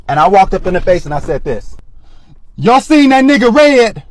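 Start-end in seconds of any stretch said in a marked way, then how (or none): 2.5-4.0 high, slow, loud street call; crazy street voice. Retold or imitated. Higher, louder street voice to homeless men at night. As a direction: act a little cuckoo so they back off. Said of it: "when I made my crazy face".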